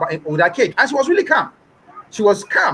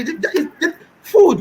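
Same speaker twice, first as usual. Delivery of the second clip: laughing; 6 semitones higher, much slower than usual. Real, not acted. Laughing mid sentence.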